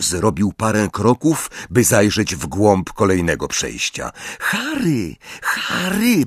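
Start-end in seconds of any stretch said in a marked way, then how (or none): 4.4-6.3 put-on high anxious girl's voice. A narrator's reading. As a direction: plead with him gently. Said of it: "powiedziała znowu Hermiona".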